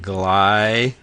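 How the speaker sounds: "gly" stretched out slowly as one separate syllable; sounding out a syllable. Shown as a demonstration. Teacher sounds out "gly" for students, slow and separate. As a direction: draw out "gly" slowly, alone, like a chunk on the board. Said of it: "going back to our previous example".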